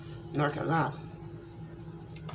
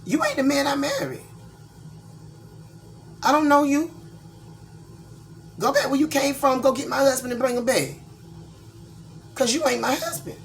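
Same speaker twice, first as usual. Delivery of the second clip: scolding husband; 9 semitones higher than usual. Retold or imitated. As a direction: tell him off hard. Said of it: "I said".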